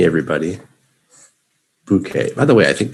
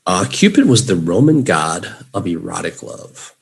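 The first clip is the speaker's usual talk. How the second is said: ordinary talk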